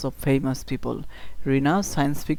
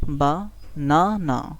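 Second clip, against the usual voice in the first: Banana slowly, the middle না swelling; three drawn-out beats in banana, middle strongest; stress on middle syllable; much slower than usual. Shown as a demonstration. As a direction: punch the second syllable of banana. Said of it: "এটা একটু জোরে বলতে হবে".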